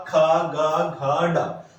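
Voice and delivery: kha, ga, gha, da sounded from the throat, half sung; velar syllables demo. Shown as a demonstration. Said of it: "वो आ जाएंगे आपके का".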